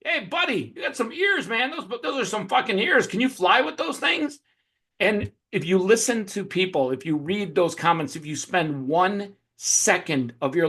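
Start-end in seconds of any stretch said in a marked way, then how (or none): 0.0-4.4 high-pitched jeering troll; mocking heckler. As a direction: needle a stranger in a raised, teasing pitch. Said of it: "some of those people are gonna be like".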